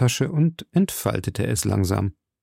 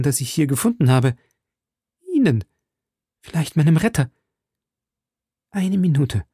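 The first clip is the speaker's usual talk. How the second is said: Milady as a frantic, pleading prisoner, higher and urgent; desperate prisoner pleading; 3 semitones higher than usual. A narrator's reading. Milady begs Felton for the knife, urgent and higher. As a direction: beg and bargain in a rush.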